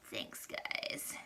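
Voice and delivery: whispered, deadpan thanks; sarcastic whisper. Retold or imitated. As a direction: breathe it out, dry and sarcastic. Sarcastic thanks after complaint.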